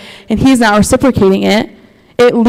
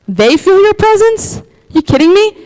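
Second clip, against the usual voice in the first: incredulous disbelief; 8 semitones higher than usual. Retold or imitated. Believer scoffing at others feeling God's presence, incredulous. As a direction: pitch it up in jealous disbelief.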